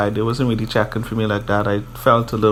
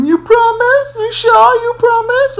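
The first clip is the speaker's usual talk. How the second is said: snotty pleading; 4 dB louder than usual. Retold or imitated. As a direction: imitate her tearful begging in a squeaky falsetto. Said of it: "she was all snotty".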